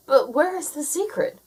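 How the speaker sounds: a bird looking around excitedly; eager Jake. A narrator's reading. Jake asks after the climb.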